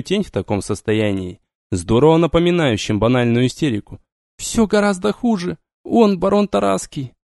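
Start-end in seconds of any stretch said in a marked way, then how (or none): 4.4-7.1 put-on young woman voice. Vampiress continues.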